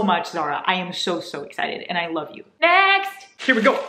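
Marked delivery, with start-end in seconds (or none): 2.6-3.8 high, loud call of next; announcing shout. Real, not acted. Calling the next package with a loud shout. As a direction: belt it out like a game show host.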